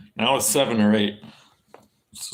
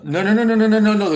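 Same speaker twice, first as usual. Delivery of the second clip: higher, louder rapid string of nos; 11 semitones higher, 7 dB louder, faster than usual. Real, not acted. Streamer loudly correcting his own claim about the time change. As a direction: shout down your own mistake.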